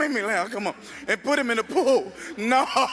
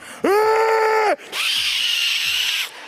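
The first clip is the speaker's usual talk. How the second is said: loud burst of laughter; 11 semitones higher, 4 dB louder than usual. Real, not acted. Big laugh mid story.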